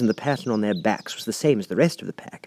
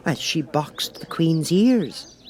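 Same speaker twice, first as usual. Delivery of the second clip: gossiping Rabbit; 8 semitones higher, slower than usual. A narrator's reading.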